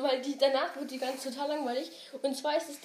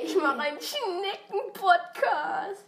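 higher, slow, whining mimicry; whiny mock podcaster; 6 semitones higher, much slower than usual. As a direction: drag it out like a whiny kid. Äfft die Podcasterin nach. (weinerlich nachgeäffte Podcasterin)

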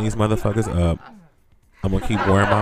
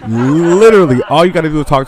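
emphatic; 5 semitones higher, 7 dB louder than usual. Real, not acted. Emphatic rant.

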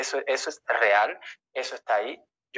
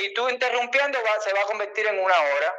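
exasperated; 7 semitones higher than usual. Real, not acted. Speaker protesting to a heckler who keeps interrupting, exasperated high pitch. Complains about being interrupted.

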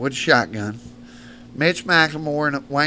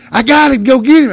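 high, loud excited call; retold hunter yelling orders; triumphant shout; 12 semitones higher, 12 dB louder than usual. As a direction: holler down from the truck rack. Retold shooter calling out.

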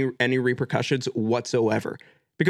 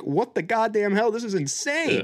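raised-pitch amused astonishment; mock amazement with a grin; grinning disbelief; 6 semitones higher than usual. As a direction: imitate a delighted, incredulous onlooker. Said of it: "a little bit of a grin on their face".

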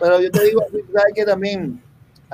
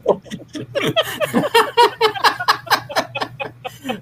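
laughter; 11 semitones higher than usual. Real, not acted. Laughing at own punchline.

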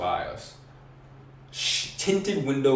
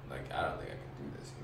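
hushed; 8 semitones lower, 13 dB quieter than usual. Retold or imitated. Retelling panicked trip moment.

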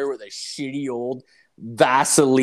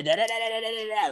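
high, sing-song da-da-da mimicking endless nagging; mock nagging babble; 10 semitones higher than usual. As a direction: babble her rant away in a high voice.

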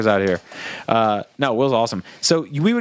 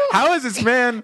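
higher, incredulous; mock disbelief; 10 semitones higher than usual. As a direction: ask it in stunned disbelief. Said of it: "I would be like".

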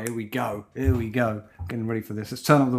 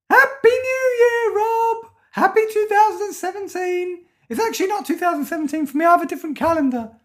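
a creepy cult believer with his own calendar; cult member; 14 semitones higher than usual. Pretend. Host playing a cult member to Rob, high eerie voice.

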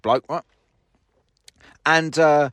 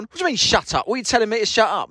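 higher, offended comeback; indignant retort; 7 semitones higher, faster than usual. Retold or imitated. Bloke challenging the driver, indignant and higher. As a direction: throw it back at him, affronted. Retold quarrel at the checkout.

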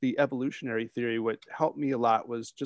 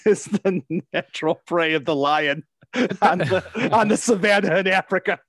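laughing; 6 semitones higher, 8 dB louder than usual. Real, not acted. Laughing through donkey joke.

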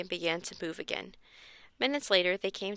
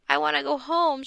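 higher, louder frightened wail; 6 semitones higher, 5 dB louder than usual. A narrator's reading. Scared boy begging to go home, wailing. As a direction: cry it out scared. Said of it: "Josh wailed".

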